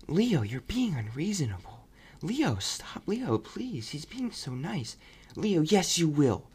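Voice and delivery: mock the family begging their dad; imitating the family. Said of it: "they're just always like".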